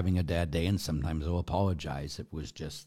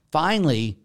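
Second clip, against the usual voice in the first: usual speaking voice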